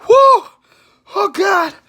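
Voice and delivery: whoop, then moan high in distress; high cry from spicy burn; burning-mouth whoop. Real, not acted. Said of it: "my mouth is on fire".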